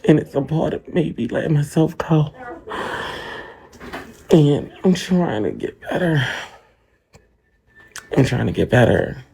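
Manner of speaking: halting, with catching breaths; tearful, overwhelmed. Real, not acted. Streamer admits to viewers she's been overwhelmed, tearful. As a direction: confess it in broken phrases, close to tears.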